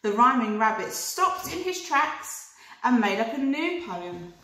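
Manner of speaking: ordinary delivery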